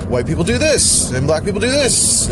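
mock a hacky comedian, swooping up on each punchline word; exaggerated sing-song mockery; mocking comedian bit. Mimicking stereotype racial comedians.

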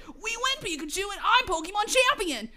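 high-pitched victory cry; triumphant young hero. Acted. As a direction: celebrate like a story hero.

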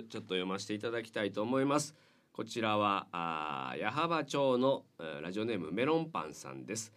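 normal talking voice

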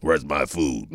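Rocco voice. Pretend. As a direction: growl out a demand for food. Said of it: "what Rocco sounds like?".

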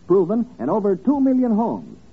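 ordinary delivery

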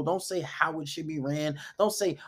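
usual speaking voice

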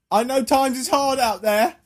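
mock Cockney accent. Persuading someone in hard times, mock Cockney accent. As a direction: coax them in hammed-up Cockney. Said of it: "like, a Cockney accent".

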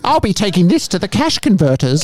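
a sly goblin eyeing profit; greedy goblin. Pretend. Joining in goblin play.

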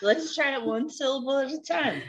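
imitating Phoebe coaching Joey; patient teacher. Teacher voice quoted from show.

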